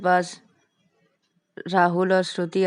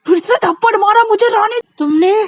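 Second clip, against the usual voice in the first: very high, loud disbelief; slapped yet again; shocked outrage; 14 semitones higher, 10 dB louder than usual. Acted. Vikas shocked by slap. (हैरानी और गुस्से में)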